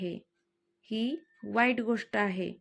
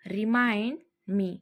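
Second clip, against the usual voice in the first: slowed-down repeat for learners; drawn-out teaching repeat; much slower than usual. Shown as a demonstration. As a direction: say it slowly and clearly. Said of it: "teacher repeats phrase twice".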